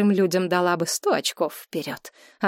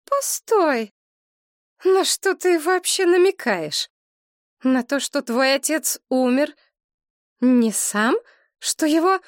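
much higher, slower, dawning fear; alarmed mother; 8 semitones higher, 3 dB louder, slower than usual. A narrator's reading. Mother grasping what her daughter is hinting, alarmed. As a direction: realize it with alarm.

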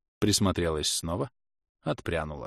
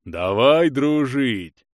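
slow, raised oafish offer; friendly giant; 4 semitones higher, 5 dB louder, much slower than usual. A narrator's reading. Giant offering friendship to the witch, slow hearty voice. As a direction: draw it out loud as a simple big lug. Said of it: "предложил тот".

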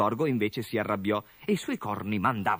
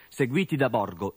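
ordinary delivery, nothing marked

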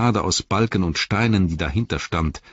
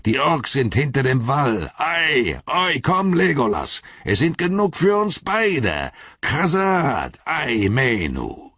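much higher, ringing battle call; gruff dwarf summoning Legolas to the fight; 9 semitones higher, slower than usual. A narrator's reading. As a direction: cry out eagerly to a comrade over the din, last words slow. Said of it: "rief er".

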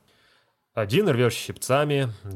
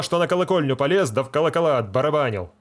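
fast, higher mocking jab; teasing; 4 semitones higher, 5 dB louder, faster than usual. Feldsher teasing the deacon, quick and mocking. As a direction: taunt the deacon about his bell-ringing. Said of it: "дразнит".

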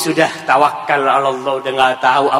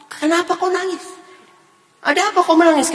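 higher, pleading parents asking their daughter why she cries; worried parents; faster than usual. Retold or imitated. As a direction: voice the anxious parents, lifted high. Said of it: "kata dia".

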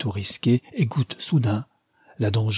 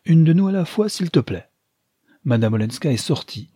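put-on mischievous old lady, a bit higher and louder; playful teasing; 6 semitones higher, 4 dB louder than usual. A narrator's reading. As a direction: tease him playfully. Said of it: "joua toute la pantomime de l'espièglerie".

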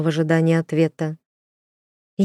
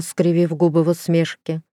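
usual speaking voice

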